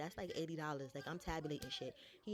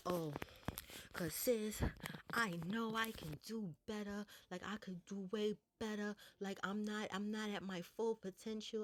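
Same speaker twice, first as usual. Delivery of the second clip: higher-pitched mock-tearful pleading; fake crying; 4 semitones higher than usual. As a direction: imitate someone putting on tears to win sympathy. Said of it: "starts crying some fake ass tears".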